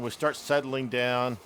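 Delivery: ordinary delivery, nothing marked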